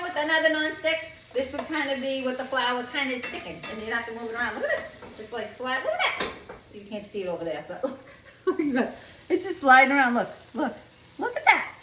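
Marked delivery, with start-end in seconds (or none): 4.6-5.2 much higher, delighted look at this; excited. Real, not acted. To viewers, showing off the nonstick pan, excited and high. Showing flour not sticking. 5.8-6.3 much higher, delighted look at this; excited. Real, not acted. To viewers, showing off the nonstick pan, excited and high. 8.2-11.7 higher and louder, laughing with delight. Real, not acted. To viewers, watching the flour slide around, laughing excitedly.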